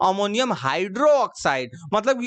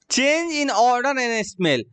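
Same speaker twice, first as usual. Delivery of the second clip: slow dictation; 3 semitones higher, much slower than usual. Shown as a demonstration. Teacher reads a heading aloud.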